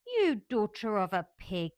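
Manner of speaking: put-on loud, furious child's insult; enraged girl insulting her maid, loud. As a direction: spit the insult out in fury. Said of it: "Mary sat up in bed furious".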